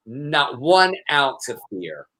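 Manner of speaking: normal talking voice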